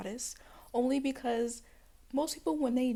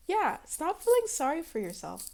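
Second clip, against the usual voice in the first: "stop feeling sorry for yourself" declared louder and higher; 6 semitones higher, 5 dB louder than usual. Shown as a demonstration. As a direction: announce it loudly to everyone. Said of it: "I need to say it very loudly".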